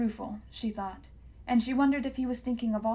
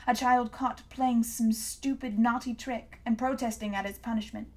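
ordinary delivery, nothing marked

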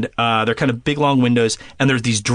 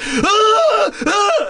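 panicked scream. Retold or imitated. Hearing whistles from the ceiling, high frightened screams. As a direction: shriek like something's in the ceiling. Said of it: "And I'm like".

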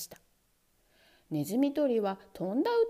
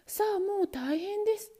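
usual speaking voice

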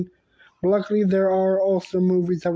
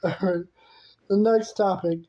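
ordinary talk

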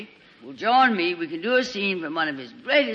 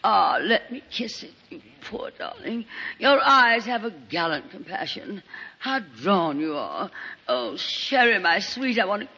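higher, breathy gushing actress; gushing Lorraine; 4 semitones higher than usual. Acted. Lorraine greeting injured Sheridan, gushing and theatrical. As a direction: fawn over him like a melodramatic diva. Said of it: "Lorraine enters the presence".

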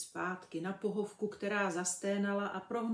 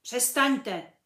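firm order, louder; commanding; 4 semitones higher, 10 dB louder than usual. A narrator's reading. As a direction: lay down the law. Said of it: "poroučel".

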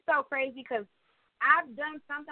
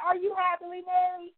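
higher, mocking repeat of the flirt's question; petty mocking echo; 8 semitones higher than usual. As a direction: throw her words back at her, petty and sarcastic. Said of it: "So I was being super petty".

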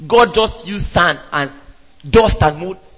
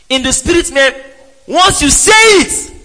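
rising preached emphasis; 8 semitones higher than usual. Real, not acted. Preacher builds to a climax.

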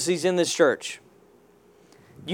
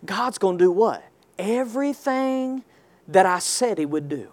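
hushed build-up; 3 semitones lower, 7 dB quieter than usual. Acted. Quiet build before the shout.

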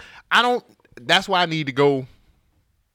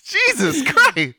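laughing; 12 semitones higher, 4 dB louder, much slower than usual. Real, not acted. Laughing at the yelling.

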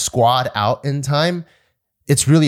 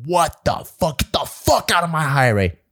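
angry drill instructor; 5 semitones higher than usual. Retold or imitated. Substitute drill instructor yells at recruit.

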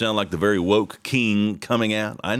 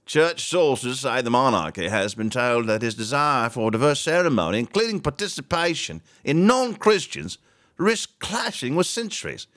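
put-on high-pitched, theatrical British newsreader accent; hammed-up British accent, pitched up; 5 semitones higher than usual. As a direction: read it out in a mock British accent. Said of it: "I should do my British accent".

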